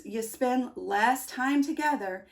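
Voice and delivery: ordinary delivery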